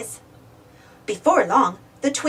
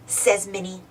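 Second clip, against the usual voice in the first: usual speaking voice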